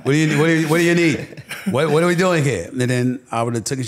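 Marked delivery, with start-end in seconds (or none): 0.0-2.7 high, loud, laughing mother voice; impersonating his mother. Retold or imitated. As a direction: do his mom fussing in disbelief. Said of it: "because you do a great impersonation".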